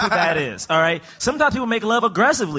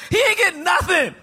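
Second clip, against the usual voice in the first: exasperated shout; 6 semitones higher than usual. Real, not acted.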